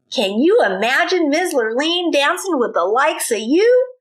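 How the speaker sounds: mocking taunt. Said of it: "said Milton".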